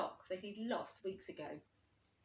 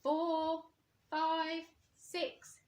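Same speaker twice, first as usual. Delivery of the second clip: higher, louder counting; counting aloud; 9 semitones higher, 6 dB louder, much slower than usual. A narrator's reading. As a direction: count out for hide and seek. Old Bear counting in game.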